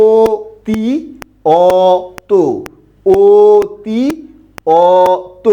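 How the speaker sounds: অতি with closed ও-like অ, then অত with open অ, repeated; contrasting drill. Shown as a demonstration. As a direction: alternate অতি and অত, stressing the vowel difference. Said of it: "আমার সাথে এইটুকু দীর্ঘ করে বলবেন".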